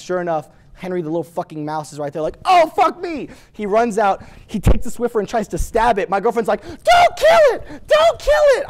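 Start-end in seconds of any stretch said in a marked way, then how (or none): 2.4-3.3 high, loud retold alarm; startled outburst. Roommate startled by the mouse. 6.6-8.6 very high, loud mock shriek; frantic girlfriend. Girlfriend pleading as mouse is stabbed.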